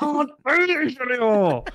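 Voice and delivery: very high, slow, hammed-up howl; mock despairing wail. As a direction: howl it out in exaggerated misery. Co-host echoes it more exaggeratedly.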